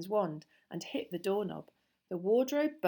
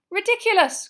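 high, loud cry of a spell; ringing high shout; cried out; 13 semitones higher, 13 dB louder than usual. A narrator's reading. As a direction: cry it out with full voice. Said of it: "cried Parvati".

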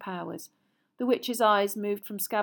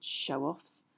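lower, softer grumble; muttered; 5 semitones lower, 7 dB quieter than usual. Acted. Ron grumbling at the skipping rats, low and soft. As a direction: mutter it under your breath. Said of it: "Ron muttered".

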